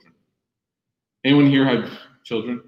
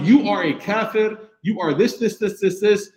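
mock condemnation; 9 semitones higher than usual. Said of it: "could have been like".

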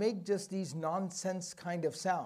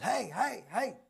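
high, repeated yelps of "hey"; shepherd yelling sounds; 4 semitones higher, slower than usual. Acted. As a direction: holler wordlessly at the herd. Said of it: "just yelling out sounds".

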